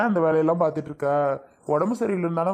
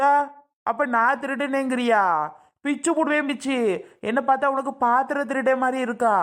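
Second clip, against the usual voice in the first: high-pitched, offended retort; indignant; 6 semitones higher than usual. Acted. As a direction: throw the accusation back with a threat. Accused of theft, threatens back. (கோபமாக, உயர்ந்த குரலில் எதிர்க்கேள்வி)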